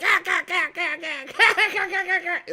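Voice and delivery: high ack ack laugh broken by real laughter; mock the cackle while giggling; laughing imitation of the ack laugh; imitating Phil's laugh. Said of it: "His laugh does go ack".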